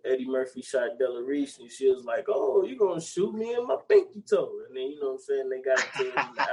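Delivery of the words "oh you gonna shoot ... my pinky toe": put-on high, indignant quoting of an old woman. Quoting the movie to a friend, high mock outrage. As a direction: squeak it out like an offended lady. Retold movie quote, [name].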